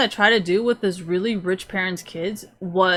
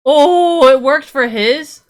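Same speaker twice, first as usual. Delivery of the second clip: loud, high, shocked "it worked for his?"; can't believe it worked; surprised outburst; 7 semitones higher, 12 dB louder, slower than usual. Real, not acted. Streamer reacting to a game result, loudly surprised.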